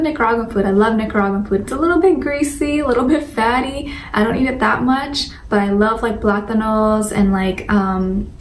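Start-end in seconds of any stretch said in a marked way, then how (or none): none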